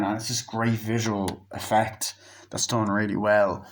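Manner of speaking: ordinary delivery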